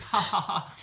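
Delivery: mock laugh, softly done; imitated laugh. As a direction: imitate a shared laugh from far away. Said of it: "And we laugh".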